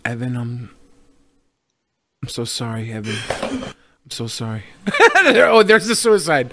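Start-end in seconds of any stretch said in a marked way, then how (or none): 0.0-4.6 quiet. Acted. Mock apology to crying fan.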